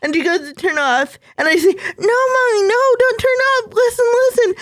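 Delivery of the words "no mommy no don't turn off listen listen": much higher, crying, begging; tearful child's plea. Retold or imitated. Little girl stopping her mom from turning off the music, crying out. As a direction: beg mom not to stop the song, through tears.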